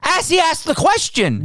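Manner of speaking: high-pitched exasperated outburst; real worked-up indignation; heated. Real, not acted. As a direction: exclaim it in disbelief, pitch shooting way up. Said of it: "I'm getting heated".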